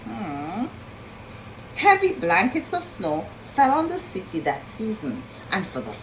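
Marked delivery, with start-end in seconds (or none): none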